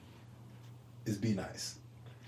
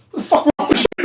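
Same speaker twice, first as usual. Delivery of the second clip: mock yell laughing; 12 semitones higher, 4 dB louder, much faster than usual. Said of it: "All they see".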